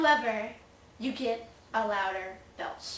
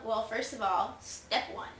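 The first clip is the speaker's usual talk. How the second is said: ordinary talk, nothing marked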